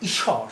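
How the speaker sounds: ঈশ্বর said as "ishshor", the ব not heard; pronunciation demo. Shown as a demonstration. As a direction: clearly model ঈশ্বর as "ishshor". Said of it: "উচ্চারণটা কি".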